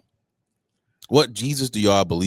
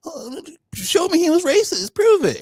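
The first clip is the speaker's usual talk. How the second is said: much higher squeaky mock voice, getting louder; mocking gaslighter; 4 dB louder than usual. Mocking people who gaslight her online, squeaky high parody. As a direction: squeal it like a smug troll demanding proof. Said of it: "people want to sit up here and gaslight you and be like".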